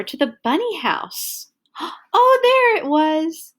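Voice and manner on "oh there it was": usual speaking voice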